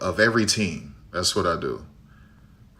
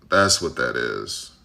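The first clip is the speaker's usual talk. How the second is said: much lower and slow, a put-on voice; low slow repeat; 8 semitones lower, slower than usual. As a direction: drop the voice way down and drag it out. Repeats his closing line lower.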